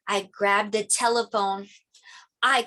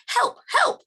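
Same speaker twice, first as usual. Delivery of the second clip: high, loud radio call for help; urgent call for help over the radio; 4 dB louder than usual. Acted. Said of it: "he said".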